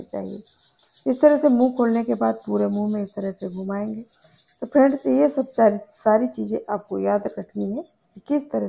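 toothbrush in mouth; speaking with mouth stretched open; brushing teeth while talking. Real, not acted. Seen 1: brush held in mouth, brushing teeth throughout the labelled words.

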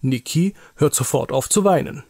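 ordinary delivery, nothing marked

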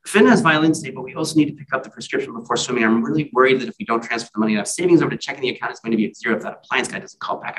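rapid-fire, breathless run-on list; auctioneer's pitch. Acted. Wife unloading the day's worries on waking, rapid auctioneer patter. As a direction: rattle it off without a gap, like an auctioneer. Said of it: "it's like walking into an auctioneer's pitch midstream".

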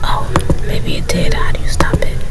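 real hushed whisper to oneself. Real, not acted. Reader to self, unsure if it recorded, whispering. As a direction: whisper it, puzzled, under your breath.

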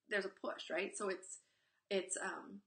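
ordinary delivery

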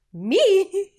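very high, loud, squeaky "Me?", caught off guard; incredulous squeak. Retold or imitated. Replaying her reaction to a colleague's praise, a high loud startled squeak. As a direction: squeal it as if the praise can't be meant for you.

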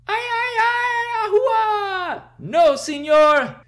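high, loud mock wailing; defeated wail. The beaten wrestler wailing at Nino, high and loud. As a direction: cry out like a beaten villain.

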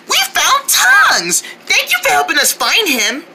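normal talking voice

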